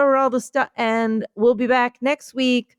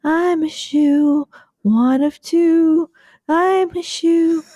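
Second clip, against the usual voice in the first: higher, slow sing-song shoe voice; talking shoe; 5 semitones higher, slower than usual. Pretend. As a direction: drawl out the shoe's lines, higher. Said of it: "I was doing the relaxing bit".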